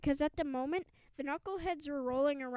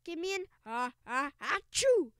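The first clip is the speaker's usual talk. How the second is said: sneezing cheer; 3 semitones higher, 3 dB louder than usual. A narrator's reading. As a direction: cheer call breaking into a mock sneeze. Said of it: "sneezed the cheerleaders".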